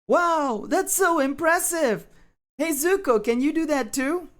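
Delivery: very high-pitched admiration; high, impressed squeal; awed anime schoolkid. Acted. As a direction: gush in a high cartoon voice. Admiring character in put-on voice.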